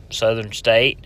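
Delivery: ordinary talk, nothing marked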